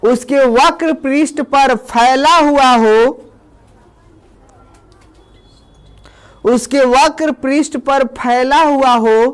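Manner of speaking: slow, word by word for students to write; slow dictation. Shown as a demonstration. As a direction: read it out slowly as dictation. Teacher dictating a definition. (धीमी आवाज़ में लिखवाना)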